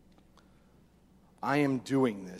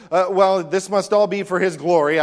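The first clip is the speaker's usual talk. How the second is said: louder, higher baffled musing; puzzled Thomas; 5 semitones higher, 9 dB louder than usual. Retold or imitated. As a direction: sound like someone trying to make sense of it. Confused Thomas voiced.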